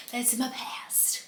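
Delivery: hushed, slowed-down whisper. Real, not acted. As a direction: breathe it out as a private aside. Closing aside, hushed.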